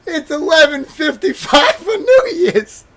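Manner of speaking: very high wailing speech through tears of laughter; crying with laughter. Real, not acted. Mocking friend's New Year's Eve, crying with laughter. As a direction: talk while laughing so hard it hurts.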